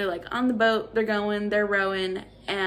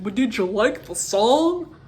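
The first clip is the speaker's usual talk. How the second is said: mock villain voice; 7 dB louder than usual. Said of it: "and he's like".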